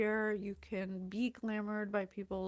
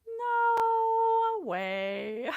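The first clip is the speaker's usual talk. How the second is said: high, loud disbelieving shout; mock outraged disbelief; incredulous no way; 12 semitones higher, 9 dB louder than usual. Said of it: "my human mind kept saying to myself".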